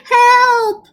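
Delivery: high, loud squeak for help; squeaky cry. Acted. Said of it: "squeaked the butcher".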